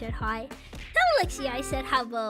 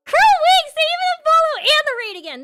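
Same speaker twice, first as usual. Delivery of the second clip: excited greeting; 6 semitones higher, 4 dB louder than usual. Real, not acted. Streamer greets raiders excitedly.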